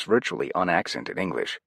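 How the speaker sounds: ordinary delivery, nothing marked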